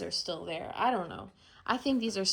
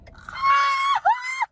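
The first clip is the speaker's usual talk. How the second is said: shrieking laugh; 6 dB louder than usual. Real, not acted. Overwhelmed by excitement.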